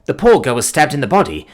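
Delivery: usual speaking voice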